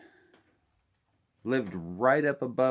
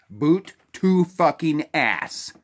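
slow, loud, higher furious bark; angry shout; 6 semitones higher, 7 dB louder, slower than usual. Real, not acted. Ranting to viewers about the government, slow and loud. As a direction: punch out each word in real rage.

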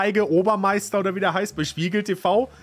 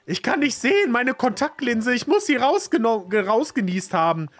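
raised-pitch worried game character; 7 semitones higher than usual. Acted. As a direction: read the anxious character's line higher. Reads game character's lines.

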